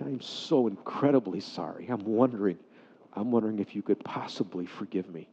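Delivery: soft, low plea for forgiveness; humbly ask, quiet and understated; retold tearful apology. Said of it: "he began to cry".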